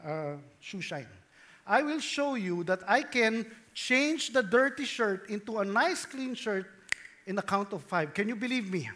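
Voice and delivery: normal talking voice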